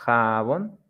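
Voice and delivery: "jabón" as ja-BÓN, rising at the end; stress on last syllable. Shown as a demonstration. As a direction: lift the second syllable of "jabón". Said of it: "like in the examples".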